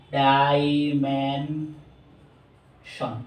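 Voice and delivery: very slow dimension, every syllable stretched. Shown as a demonstration. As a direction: pull dimension apart slowly for the class. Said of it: "চারবার উচ্চারিত". (Dimension খুব ধীরে টেনে)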